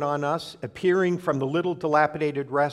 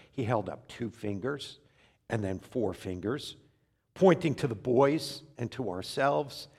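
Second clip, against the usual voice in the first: usual speaking voice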